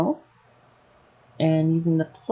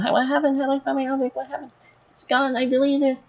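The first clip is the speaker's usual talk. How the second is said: higher-pitched voice of a panicking newbie; mock panic; 8 semitones higher, faster than usual. Tutor imitating new Blender users who lost their object, mock panic. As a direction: play a flustered beginner, pitched well up. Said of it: "they're sitting here panicking".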